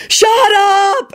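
very high collective shriek; screamed. A narrator's reading. Horrified family to gleeful boy, screaming. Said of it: "everyone screamed".

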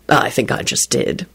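low, slow, impassive reply. A narrator's reading. Dad replying to his squealing daughter, impassive. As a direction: answer unmoved, low and slow. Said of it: "he replied impassively".

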